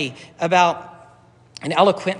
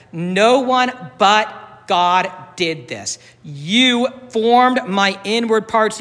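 slow, heavy stress on You; emphatic reading; much slower than usual. Shown as a demonstration. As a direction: lean hard on "You" slowly. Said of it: "It's emphatic".

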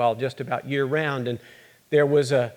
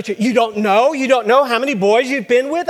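much higher and louder, incredulous and scolding; angry accusation; 9 semitones higher, 7 dB louder than usual. Acted. Mother accusing her daughter, high and angry. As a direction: hurl the question at her in disbelief.

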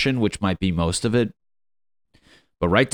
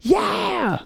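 very high shouted cheer; crowd cheering. Retold or imitated. Audience cheering the breakup, mock shouted yeah. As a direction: whoop like a hyped crowd. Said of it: "people in the background going".

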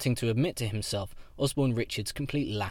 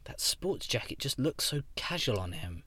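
ordinary delivery

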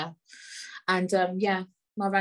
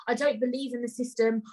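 ordinary delivery

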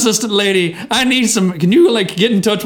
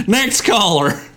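normal talking voice

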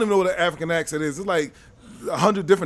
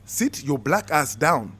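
mock-menacing African accent; African-accented captain laying down the law; 2 semitones lower than usual. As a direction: give orders in a put-on African accent. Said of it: "That's my best African accent".

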